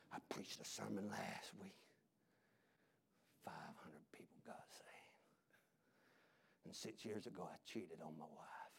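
very low, barely voiced mock evangelist; hushed confession. Evangelist owning up to cheating on his wife, hushed whisper.

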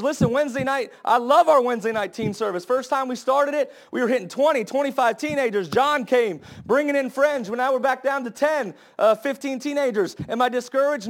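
stirring pulpit crescendo; fired-up preaching. Acted. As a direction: preach it with rising fire. Preaching at full force.

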